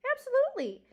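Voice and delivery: high, loud emphatic yes. A narrator's reading. Porcupine reassuring the nervous mouse, high and loud.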